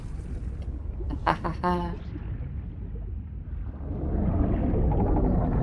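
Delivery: soft unvoiced nervous laugh; breathy awkward laugh. Real, not acted. Awkward laugh after dark scene.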